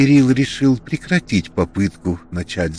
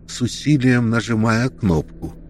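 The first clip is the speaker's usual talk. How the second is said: ordinary delivery, nothing marked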